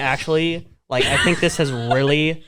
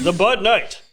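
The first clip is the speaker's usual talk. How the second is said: announcer voice; 5 semitones higher than usual. Pretend. Announcer voice, Bud Night.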